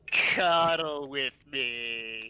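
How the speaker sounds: slow, flat, lifeless monotone. Retold or imitated. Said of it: "Just in that flat monotone voice".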